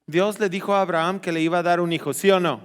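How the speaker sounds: ordinary talk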